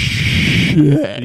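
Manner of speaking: loud, drawn-out mock dread; imitated wide-eyed alarm, drawn out; shocked. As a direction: stretch the curse as realization lands. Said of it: "shock wide open".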